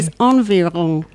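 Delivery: environ said French style, nasal on-vee-ron; French pronunciation. Shown as a demonstration. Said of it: "It's me doing a French accent".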